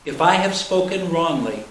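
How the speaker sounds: ordinary talk, nothing marked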